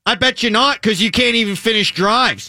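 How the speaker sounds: put-on pushy drunk fan needling the coach; obnoxious Pittsburgh bar heckler; heckling fan. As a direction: lean in and heckle him.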